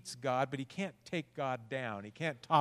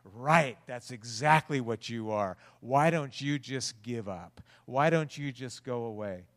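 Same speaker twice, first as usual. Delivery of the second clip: taunting devil; 8 dB louder than usual. Acted. The devil taunting a despairing believer, loud and jeering. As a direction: sneer it down at them like the devil. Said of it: "And the devil's going".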